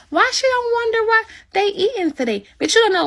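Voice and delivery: sarcastic imitation of the chat, ending in a high singsong; mocking the nosy viewers. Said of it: "y'all over here dangling scenes".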